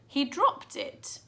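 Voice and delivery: puzzled. A narrator's reading. Puzzled boy musing.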